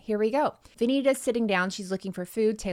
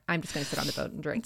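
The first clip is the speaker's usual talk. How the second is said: fast mock Craig; 4 semitones lower, 6 dB quieter, much faster than usual. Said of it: "he's like".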